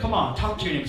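ordinary delivery

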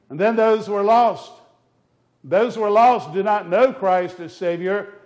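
loud, high pulpit thunder; fiery preaching. Acted. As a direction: preach it at full force to the congregation. Warning about the devil's power.

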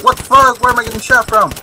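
high, loud startled squeal; mock panicked enemy. Gamer voicing enemy being shot, high mock panic. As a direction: voice the confused soldier in a panic. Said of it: "He's like".

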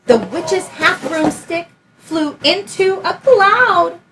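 put-on lively read-aloud for a child; story narration. A narrator's reading. Teacher reading Room on the Broom aloud, lively storytelling. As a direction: tell it like a bedtime picture book. Reads picture book aloud.

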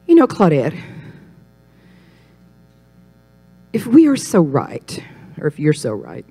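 very slow, drawn-out question; imitated churchwoman, slow and deliberate; ponderous challenge. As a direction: stretch the words with long pauses. Older churchwoman's challenge, acted.

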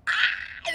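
high-pitched little shriek; excited squeal. Real, not acted. Collector breaks off explaining, excited squeal. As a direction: squeal mid-sentence, overcome. Involuntary squeal mid-sentence.

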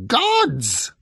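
high, loud, piercing outburst; eagle screech. A narrator's reading. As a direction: screech GODS as an impatient correction. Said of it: "screeched the eagle".

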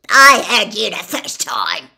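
loud, angry snap; snapped. A narrator's reading. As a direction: bite the words off. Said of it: "snapped Gaunt".

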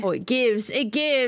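ordinary delivery

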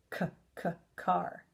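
soft repeated K, then "car"; sounding out car. Shown as a demonstration. Teacher sounding out a word's first letter. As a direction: tap out the K sound before "car". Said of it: "What does car start with?".

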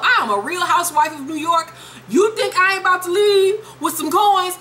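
high-pitched sassy defiance; indignant wife. Retold or imitated. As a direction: play the wife refusing to be cheated. Voicing indignant wife at divorce hearing.

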